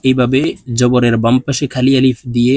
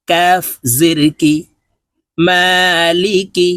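letters named, then each word drawn out slow and higher; patient tutor reciting a reading drill; slow spelling drill; 3 semitones higher, slower than usual. Shown as a demonstration. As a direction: sound out and blend each word slowly for learners. Teacher spells and blends Arabic words.

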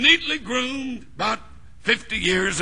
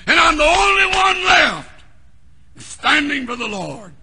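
higher and louder, wailing lament; hammed-up despairing cry; 6 semitones higher, 8 dB louder than usual. Elijah under the juniper tree, crying out in despair. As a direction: cry out like a lonely prophet.

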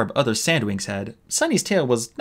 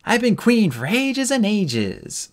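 slow, higher, hammed-up royal voice; pompous queen; 11 semitones higher, slower than usual. Sunny pretending to be queen for friends, hammed-up pompous. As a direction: play a grand queen badly on purpose. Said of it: "Sunny went on".

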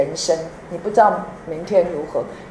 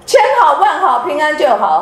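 laughing; 8 semitones higher, 5 dB louder than usual. Real, not acted. Laughs through blessing.

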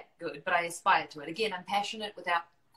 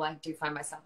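ordinary delivery

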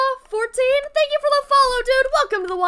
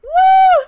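loud high cheering whoop; excited whoop; 10 semitones higher, 12 dB louder than usual. Real, not acted. Streamer whooping at a viewer's gift, loud. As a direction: whoop with joy.

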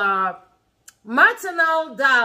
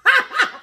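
high, loud burst of laughter; amused high-pitched laugh; 12 semitones higher, 5 dB louder than usual. Real, not acted. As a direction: crack up suddenly. Amused by the child's repeated threat.

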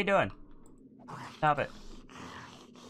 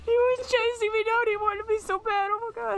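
panicked; 11 semitones higher than usual. Real, not acted. Fleeing a monster in-game.

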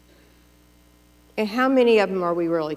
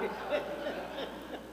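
airy unvoiced giggle; soft breathy laugh; 6 semitones higher, 12 dB quieter than usual. Real, not acted. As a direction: laugh under your breath. Laughing at her mother's pans.

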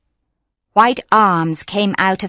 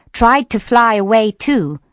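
ordinary delivery, nothing marked

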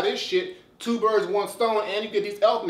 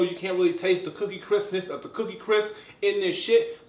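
ordinary talk

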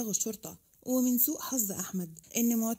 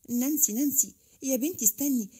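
lightly raised calling voice; calling after her; 3 semitones higher, 4 dB louder than usual. A narrator's reading. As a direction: call out to make her wait. Calling after someone hurrying away. (نداء بصوت مرفوع شوية)

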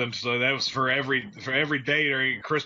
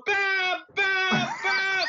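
very high shrill mock calling; much higher imitated woman calling out; girlfriend calling; much slower than usual. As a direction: wail for Bob like the panicked girlfriend. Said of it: "banging on the door".

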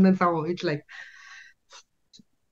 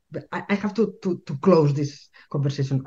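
normal talking voice

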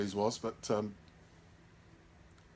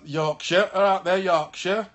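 louder, higher Yorkshire hail; Yorkshire accent; 5 semitones higher, 6 dB louder, slower than usual. Pretend. Greeting viewers from Yorkshire in their accent. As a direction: call out in a playful Yorkshire accent.

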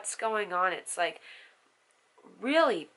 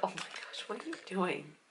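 whispering; 4 semitones lower, 15 dB quieter than usual. Real, not acted. Whispering at baby.

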